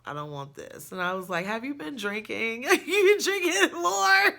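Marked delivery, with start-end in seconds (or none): 1.5-4.3 incredulous question breaking into laughter; high, loud laughing retort; laughing disbelief. Retold or imitated. Retold confronting boyfriend, laughing.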